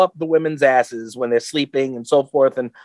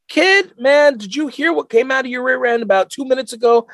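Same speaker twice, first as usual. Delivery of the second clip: raised-pitch parental protest; mock incredulous dad; 7 semitones higher than usual. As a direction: act the dad calling out the kid. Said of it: "and I'm like".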